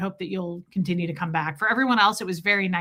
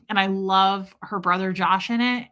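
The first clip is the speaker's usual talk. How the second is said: normal talking voice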